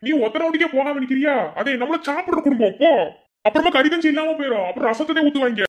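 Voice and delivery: loud, high-pitched scolding uncle. Acted. Uncle loudly scolding his niece to go eat. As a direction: bark at her to go eat. Uncle boasting, urging her go.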